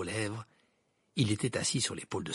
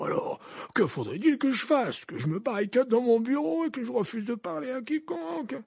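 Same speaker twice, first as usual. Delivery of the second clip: put-on high, loud old wizard voice; gently probing old headmaster; Dumbledore voice; 12 semitones higher, 4 dB louder than usual. A narrator's reading. Dumbledore asks Hagrid about Hermione's shout, raised old wizard voice.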